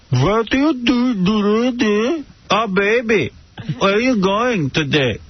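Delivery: high, drawn-out mock Romanian accent. Imagined woman greeting her partner, mock Romanian accent. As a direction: exaggerate a Romanian woman's sing-song accent. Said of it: "That accent".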